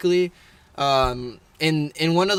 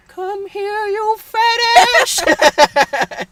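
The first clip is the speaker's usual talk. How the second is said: put-on high old man voice, building louder; Herbert the Pervert; 5 dB louder, much slower than usual. Old man calling Chris back, high creepy voice. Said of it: "that's Herbert the Pervert".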